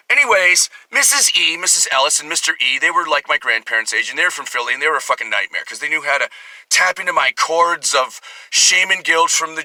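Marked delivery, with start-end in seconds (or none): none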